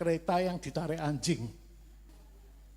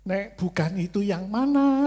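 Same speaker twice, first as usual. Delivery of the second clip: louder, higher frustrated plea; exasperated loud retort; 5 semitones higher, 8 dB louder than usual. Retold or imitated. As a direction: mock-desperate demand for an answer. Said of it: "Lah maksud saya itu". (protes keras yang kesal, agak tinggi)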